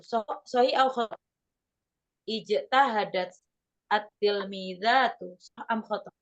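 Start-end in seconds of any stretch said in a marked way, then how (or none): 2.3-5.4 slow reading. Shown as a demonstration. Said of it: "pelan-pelan".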